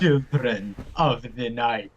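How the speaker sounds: low, slow vampire voice; spooky voice. Acted. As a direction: drag it out low like a creepy vampire. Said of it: "resident vampire".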